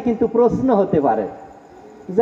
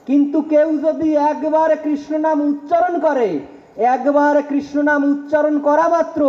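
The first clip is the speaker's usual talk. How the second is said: fervent preaching; 4 semitones higher than usual. Acted. Preacher proclaiming scripture to the congregation, fervent. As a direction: build the promise, then land it.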